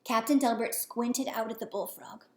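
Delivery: ordinary delivery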